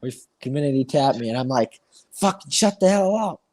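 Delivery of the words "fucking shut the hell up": higher, exasperated retold outburst; pissed off. Telling off the friend who keeps tapping him, pissed. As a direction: re-enact snapping at a pest. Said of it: "I was getting really pissed".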